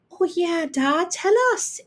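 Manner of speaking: bright eager high plea; a child chirping for a story; chirped. A narrator's reading. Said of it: "Ailish chirped".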